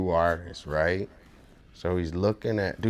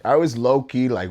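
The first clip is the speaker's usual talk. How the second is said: ordinary talk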